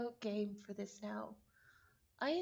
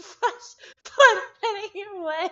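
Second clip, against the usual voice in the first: 1 laughing; 5 semitones higher, 18 dB louder, slower than usual. Real, not acted. Laughs while reading narration.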